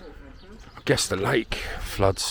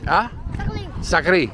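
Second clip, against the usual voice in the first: calling out; 9 semitones higher than usual. Real, not acted. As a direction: speak up clearly so they understand. Calling to children.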